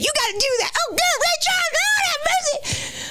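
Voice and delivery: very high, hammed-up churchy shouting; excited family. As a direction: squeal it like an overjoyed auntie at church. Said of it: "my whole family's like".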